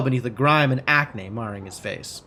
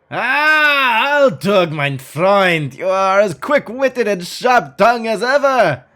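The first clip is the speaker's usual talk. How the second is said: much higher, loud and slow, laughing; booming German-tinged giant; hearty German roar; 6 semitones higher, 6 dB louder, much slower than usual. Acted. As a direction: roar with delight, bowing grandly. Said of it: "raucous belly laugh".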